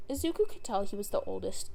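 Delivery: ordinary delivery, nothing marked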